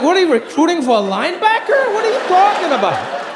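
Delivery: high laughing disbelief at dad; incredulous. Retold or imitated. Son mocking dad's odd taste, incredulous. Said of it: "I'm like".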